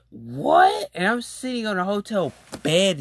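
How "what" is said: surprised what. Retold or imitated. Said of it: "And I was like".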